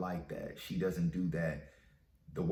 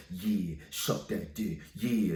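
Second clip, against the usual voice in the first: slow, put-on smooth ladies' man; mocking player voice; slower than usual. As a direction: imitate the cocky guy from the video, drawn out. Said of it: "sounding like goddamn Wesley Pipes".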